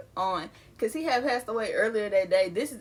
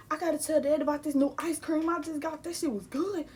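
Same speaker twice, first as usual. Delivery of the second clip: higher, eager retelling; excited gushing; 6 semitones higher than usual. Retold or imitated. Child wanting to tell Dad about ice cream, excited.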